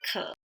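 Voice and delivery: "ke" low and dropped; third tone. Shown as a demonstration. Teacher introducing third tone, "ke" low. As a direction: keep "ke" down at the bottom of your pitch. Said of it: "look at the third tone".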